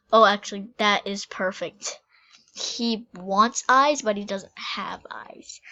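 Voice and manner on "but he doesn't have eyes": secret whispered aside; quick hushed confiding whisper. Real, not acted. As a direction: lean in and let them in on it. Aside whispered about character.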